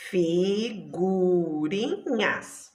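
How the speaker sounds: Figurinhas very slowly split as fi, gu, ri, nhas; syllables separated. Shown as a demonstration. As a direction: stretch each syllable of Figurinhas.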